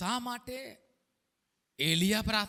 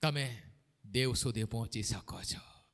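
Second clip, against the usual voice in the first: whisper; 11 semitones lower, 8 dB quieter than usual. Acted. Preacher drops to intimate point.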